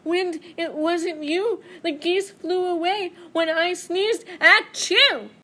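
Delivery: high, loud, slow protesting bear. Acted. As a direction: Bear insisting it was his sneeze. Bear arguing back to Wind.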